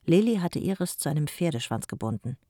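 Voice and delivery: ordinary delivery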